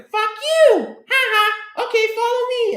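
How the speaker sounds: high, cackly creature voice; little alien. Retold or imitated. Alien won over by the player swearing back, high squeaky voice. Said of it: "And the guy's like".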